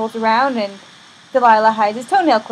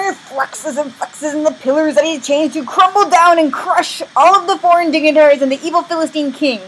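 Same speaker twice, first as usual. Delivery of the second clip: strained start building to loud, high storytelling; hammed-up heroic climax; epic climax narration; 5 semitones higher, 5 dB louder than usual. A narrator's reading. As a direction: tell it like the big action finale.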